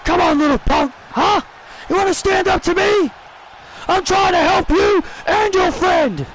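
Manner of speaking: high shouted heel taunt; angry wrestler taunt. Acted. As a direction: yell it down at the beaten opponent. Kevin Owens voiced taunting.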